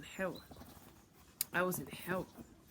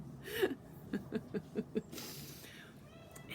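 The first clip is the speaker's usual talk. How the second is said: long, rapid-pulsed cackle; mocking laugh; 4 semitones higher than usual. Being told he needs love, mocking laugh. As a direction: keep cackling at the idea.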